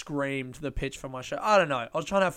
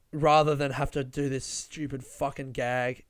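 ordinary talk

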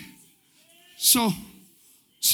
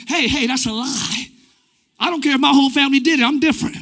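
ordinary talk

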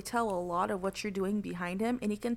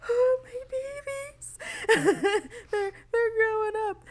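high, slow, teary proud parent; mock weepy proud parent; 5 dB louder than usual. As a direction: gush like a proud mother. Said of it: "I'm just kinda sitting here just like".

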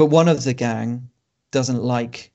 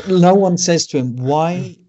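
exasperated; 6 semitones higher, 6 dB louder than usual. Acted. Exasperated complaint about actor's accent.

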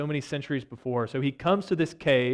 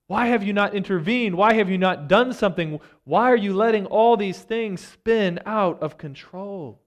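high, louder pleading questions to God; raised exasperated pleading; 5 semitones higher, 5 dB louder than usual. Retold or imitated. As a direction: press God for answers, voice raised. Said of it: "he's basically saying".

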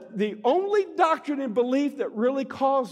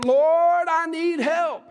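acted plea, louder and higher, first word stretched; cry for help; 4 semitones higher, 4 dB louder, much slower than usual. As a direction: call out to God as if desperate. Acted desperate prayer for help.

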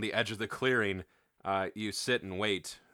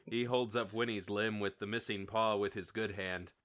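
ordinary delivery

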